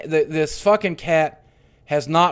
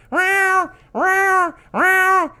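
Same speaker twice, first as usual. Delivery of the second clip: high, thin kitten meows, much higher and louder; cat meowing; 5 dB louder, much slower than usual. Retold or imitated. Narrator imitating the buried cat's meows, high and loud. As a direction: mew like a weak dying kitten. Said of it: "I hear".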